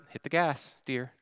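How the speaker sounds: soft, controlled instruction; gently understated urging; calm. Retold or imitated. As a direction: keep it quiet and composed. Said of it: "so I calmly say to her".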